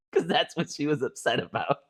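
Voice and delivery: usual speaking voice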